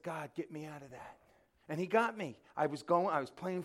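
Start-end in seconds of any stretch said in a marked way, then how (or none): 0.0-1.2 soft, low prayer to God; quiet desperate plea; much softer whispered-toward prayer; hushed plea. Retold or imitated.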